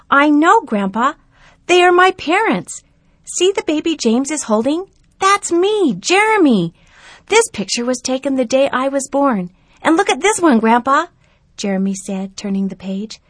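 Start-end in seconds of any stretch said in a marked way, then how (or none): 0.1-11.1 put-on high, loud boy's voice, eagerly explaining; a boy showing his grandpa family photos; eager young boy.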